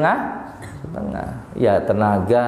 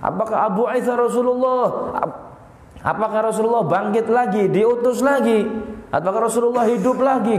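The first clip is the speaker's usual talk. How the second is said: much higher, excited questioning; astonished crowd; 10 semitones higher than usual. Acted. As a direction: cry out in wonder.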